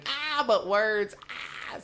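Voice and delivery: drawn-out, high mock scream of a meme girl. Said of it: "we should put that girl screaming".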